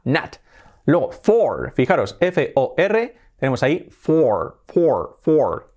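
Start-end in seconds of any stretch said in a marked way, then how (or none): 0.0-0.4 "not" said "nat", open A. Shown as a demonstration. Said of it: "con una A". 3.9-5.7 "for" with a strong English R at the end; drawn-out "for" ending on a firm R. Shown as a demonstration. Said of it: "con la R bien fuerte".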